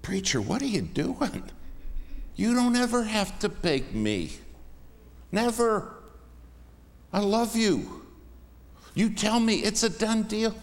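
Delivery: high, heartfelt "you don't have to beg me"; earnest protest. Retold or imitated. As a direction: rise way up as you wave off his begging. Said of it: "I'd say".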